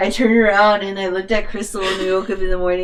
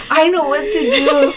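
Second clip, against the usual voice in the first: high drunk-friend imitation; drunk confidence; 6 semitones higher than usual. Drunk friend takes charge of unconscious pal, mock tipsy confidence. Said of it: "All drunk, right?".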